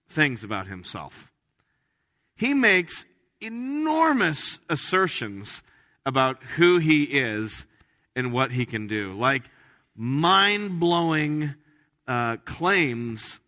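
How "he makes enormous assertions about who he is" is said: slow and higher, leaning on enormous assertions; slowed and lifted, stressing enormous; emphatic. Real, not acted. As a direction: stretch it out to make it feel big. Preacher emphasizing Jesus's claims.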